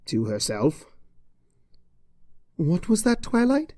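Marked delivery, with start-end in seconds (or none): 2.6-3.7 worried teacher checking on her; teacher Cheerilee asks if she's all right, higher; Cheerilee's voice. Acted.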